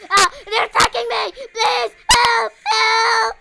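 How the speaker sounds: high, loud screams for help; hammed-up terrified yelling; mock panic cries. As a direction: shriek for rescue. Under attack in game.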